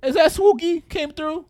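high loud hello; excited greeting. Real, not acted. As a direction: call out happily to a friend. Greeting friend arriving.

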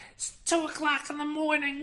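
high, loud, outraged yell; irate shout across the street at night; angry neighbour shout. Acted. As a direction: yell it out of a window at someone. Neighbour yelling out of window at night.

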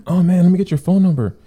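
hammed-up eager ask for the number; high, loud mock eagerness. Quoting the eager passenger.

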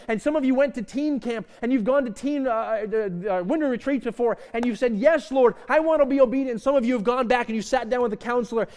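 fervent preaching voice; preaching at full force. Real, not acted. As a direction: lift the room with promise.

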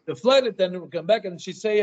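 normal talking voice

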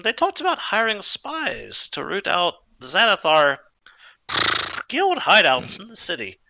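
slow, drawn-out horse mare; old horse voice. Acted. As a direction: slowly recall as an aging horse. DM voicing Maxine the horse.

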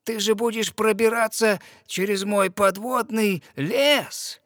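the witch warning her slyly; sea witch. Acted. Witch gives advice on polyps.

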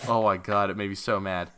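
normal talking voice

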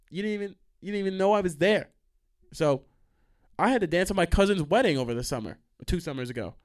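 ordinary talk, nothing marked

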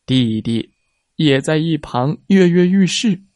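plain reading voice

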